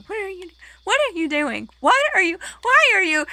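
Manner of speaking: imitating a baffled friend; confused mock yelling. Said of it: "you just kept going".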